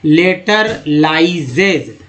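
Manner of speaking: लेटरलाइजेज stretched very slowly, each syllable clear; slow careful pronunciation. Shown as a demonstration. Teacher to students, slow pronunciation model. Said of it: "उच्चारण है".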